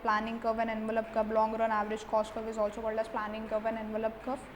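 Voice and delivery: slow, word-by-word dictation pace; slow note dictation. Acted. Teacher dictating notes to students, slow and deliberate. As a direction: spell it out so students can write it down.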